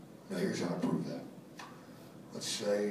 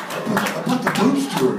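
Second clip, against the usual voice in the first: higher, much louder, fast words through laughter; laughing; 11 semitones higher, 13 dB louder, faster than usual. Real, not acted. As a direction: spit it out while still laughing. Still laughing through the line.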